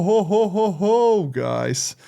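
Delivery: ordinary delivery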